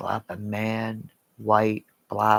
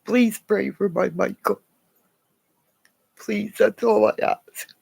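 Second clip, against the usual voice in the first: high, pleading, close to tears; tearful plea; 7 semitones higher, 3 dB louder than usual. Real, not acted. Asking viewers to pray for Michael, tearful plea. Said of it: "I'm sorry I'm crying".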